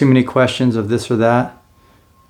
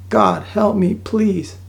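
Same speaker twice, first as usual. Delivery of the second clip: high-pitched reenacted cry for help; acted-out desperate prayer; desperate plea; 9 semitones higher, much slower than usual. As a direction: plead upward to God, voice raised high.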